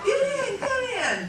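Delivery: very high, pleading call; urgent calling. Retold or imitated. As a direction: beg her to get in, squeaking. Husband calling wife into plane.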